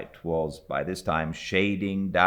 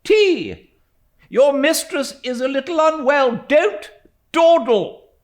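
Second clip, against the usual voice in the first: loud, higher barked orders; commanding; 12 dB louder than usual. A narrator's reading. Stern aunt ordering the servant, loud commands. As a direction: order the servant about sharply.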